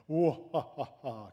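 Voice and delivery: loud villain cackle "ha, ha"; evil laugh. Shown as a demonstration. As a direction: laugh like a movie bad guy. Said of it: "let's do an evil laugh together".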